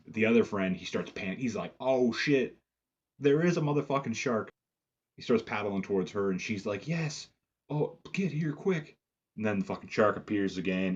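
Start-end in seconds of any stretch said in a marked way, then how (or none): 1.8-4.5 raised, louder imitation of alarm; mock panic. Friend starts panicking, sees shark. 6.9-9.0 high, drawn-out imitation of calling someone over; mock urgent call. Woman urges swimmer towards her.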